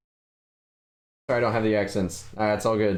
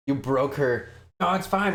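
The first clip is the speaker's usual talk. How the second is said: usual speaking voice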